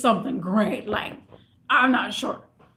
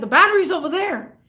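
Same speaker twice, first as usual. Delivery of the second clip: imitating a snippy customer, higher pitch; nipping; 5 semitones higher, 4 dB louder than usual. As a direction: snap it like an impatient customer. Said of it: "he's already nipping at me".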